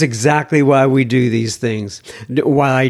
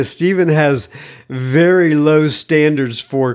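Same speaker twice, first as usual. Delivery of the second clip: ordinary delivery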